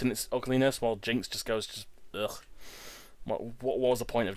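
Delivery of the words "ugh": low, soft groan of distaste; hushed, low noise of disgust; disgusted ugh. Real, not acted. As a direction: let out a quiet, revolted ugh. Disgust at Jynx's looks.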